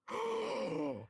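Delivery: loud high gasp; excited gasp. Acted. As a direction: gasp in delight. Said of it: "She's like".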